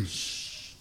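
a quick whispered shh to quiet him; soft unvoiced shush; hushing shh. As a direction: hush him with a breathy shh. Hushing the fighter before a punch.

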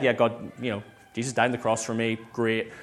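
low, soft, quick and unimpressed; casual shrug of a reply; indifferent acknowledgement. Acted. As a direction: toss it off as old news. Acting out mere head knowledge.

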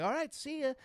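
very high, chirpy goodbye; a taxi drops a tourist off, chirpy; breezy driver voice. Retold or imitated. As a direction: toss it off cheerfully.

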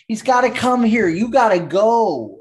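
excited pleading. Pretend. Pleading to player.

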